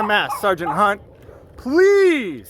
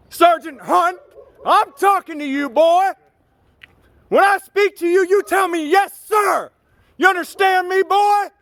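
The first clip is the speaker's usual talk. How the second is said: angry shouting; 6 semitones higher than usual. Real, not acted. Berating the sergeant, demanding to be called sir: high angry shouting. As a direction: bark it down at him like a drill sergeant.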